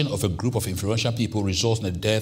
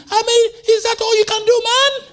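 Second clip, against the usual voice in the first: much higher, much louder preaching cry; full-force preaching; 8 dB louder than usual. Acted. Preacher telling the congregation of the rebellion, at full force. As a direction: preach it out to the back row.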